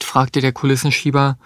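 usual speaking voice

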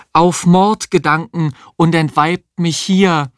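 high-pitched stage declamation of Macbeth; theatrical declamation. A narrator's reading. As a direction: declaim the line like an actress rehearsing. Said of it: "aus Macbeth deklamieren". (theatralisch deklamiert)

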